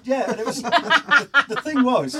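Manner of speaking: very high, loud and slow through laughter; helpless laughter mid-story; laughing. Real, not acted. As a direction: choke on the laughter.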